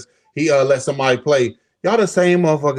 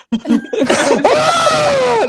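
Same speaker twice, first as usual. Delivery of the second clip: mock wail. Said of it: "they go".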